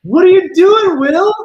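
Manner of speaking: very high, loud yell at the player; exasperated yell. Real, not acted. Streamer yelling at Will for his throw, loud exasperated shout. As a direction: scold the player through the screen.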